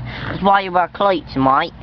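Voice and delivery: mock Aussie accent, "cleats" as "cloits"; put-on Australian accent. Vlogger correcting the country slip, put-on Australian accent. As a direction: say it like an Aussie, "mate" as "mite". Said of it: "my accent goes away".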